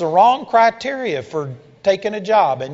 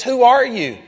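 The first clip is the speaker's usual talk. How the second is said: startled loud question; 7 semitones higher, 6 dB louder than usual. Retold or imitated. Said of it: "And she says".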